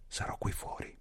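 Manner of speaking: soft unvoiced whisper. A narrator's reading. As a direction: whisper it at the bedside. Henry sussurra alla morente. (sussurrato piano)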